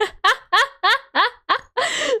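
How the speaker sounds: a high, loud laugh bursting out; high burst of laughter. Real, not acted. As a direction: laugh out loud at your own joke. Laughing at own refusal.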